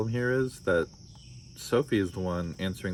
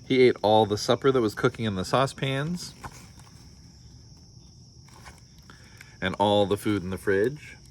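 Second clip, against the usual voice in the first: storybook read-aloud with voiced characters; story narration; 3 semitones higher, 3 dB louder than usual. A narrator's reading. Parent reading a picture book to listeners, lively storytelling. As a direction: read it like bedtime story time.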